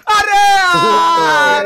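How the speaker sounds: loud cry of dismay; anguished shout. Real, not acted. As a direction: yell out in frustration. Getting hit in the game. (दर्द भरी चीख)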